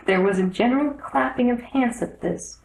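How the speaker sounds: plain reading voice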